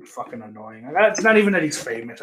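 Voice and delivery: ordinary talk, nothing marked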